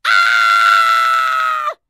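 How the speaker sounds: high loud shriek; shocked scream. Acted. Donut hits nose.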